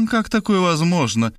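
high-pitched, lost question; bewildered. A narrator's reading. The other woman asks about the body, bewildered. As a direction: ask it at a loss. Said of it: "растерянно спросила другая".

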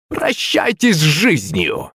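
slow ominous villain voice from the oak; booming echoing villain monologue. A narrator's reading. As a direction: draw out a sinister threat. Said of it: "зловещий голос".

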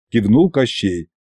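plain reading voice

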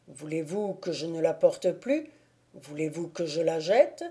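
a lightly voiced anxious suitor; Thibault, anxious. A narrator's reading. Thibault offers to discard ring.

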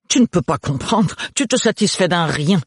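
a touch of spousal exasperation; exasperated wife. Acted. Wife quarrels with husband.

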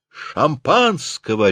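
slow, high barked order; dictator's bark. A narrator's reading. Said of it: "рявкает Ризенфельд голосом диктатора".

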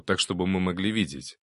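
ordinary delivery, nothing marked

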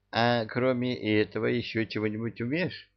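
soft, sly challenge; sly witch voice. Acted. Witch testing him.

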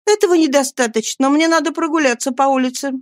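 high-pitched woman insisting; insistent Nancy. A narrator's reading.

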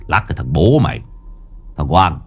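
low grumbling retort; gruff old boss. A narrator's reading. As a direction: snap back, then question.

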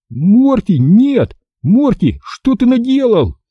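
high-pitched alarmed scolding; crying out. A narrator's reading. Boy scolds his dog at the bowl, crying out. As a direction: cry out high, at moderate volume. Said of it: "закричал я".

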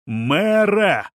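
high, urgent shout; yelling. A narrator's reading. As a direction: yell her name to get through to her. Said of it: "орал Понтер".

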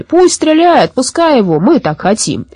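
high, insistent call falling back at the end; clamouring crowd. A narrator's reading. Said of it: "Но все загалдели".

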